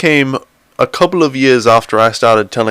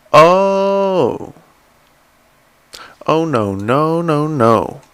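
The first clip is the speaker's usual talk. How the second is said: panicked; 6 semitones higher, 4 dB louder, much slower than usual. Real, not acted. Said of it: "I messed it all up".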